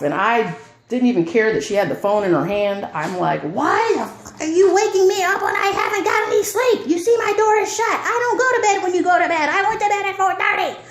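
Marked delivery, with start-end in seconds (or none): 3.4-10.8 higher, furious retold yelling; yelling angrily. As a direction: go off on her, angry. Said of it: "So I'm going off".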